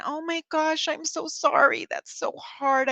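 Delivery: high, syrupy "I'm so sorry" parody; mock pity. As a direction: overdo the sympathy to mock it. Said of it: "I felt like telling her".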